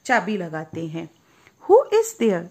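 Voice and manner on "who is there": high, loud call through the door; rosebud asking. Acted. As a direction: call out who's knocking. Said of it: "she asked". (ऊँची आवाज़ में पुकारती कली)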